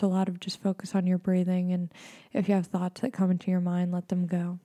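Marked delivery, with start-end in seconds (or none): none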